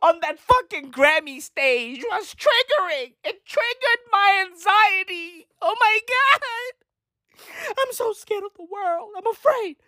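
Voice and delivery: high, panicky, half-crying mock whine; mock triggered snowflake. Mocking Gen Z over a post, in a high, weepy, panicky whine. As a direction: parody a hysterical anxious kid, way up high.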